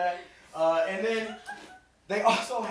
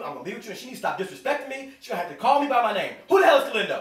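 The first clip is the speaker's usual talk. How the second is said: higher, louder comic rant; mad husband; 6 semitones higher, 8 dB louder, faster than usual. Retold or imitated. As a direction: build to an indignant outburst. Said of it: "So, like, I'm getting mad".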